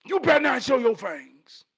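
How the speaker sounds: loud, high-pitched scolding wife; wife's warning. Retold or imitated. Wife warning husband about his fangs, loud scolding. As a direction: imitate a wife warning her husband loudly. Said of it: "My wife said".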